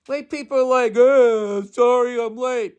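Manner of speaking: exaggerated self-parody, way up high; Bill Burr impression. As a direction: belt it out high as a parody of yourself. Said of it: "my impression of people's impression of me".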